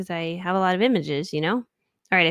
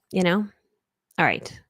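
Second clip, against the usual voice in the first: normal talking voice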